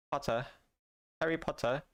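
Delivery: British "Potter" with a dropped final r; British accent. Shown as a demonstration. Streamer showing chat the one British word he can do. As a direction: clip the r off "Potter", British style. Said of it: "I only know how to say Potter".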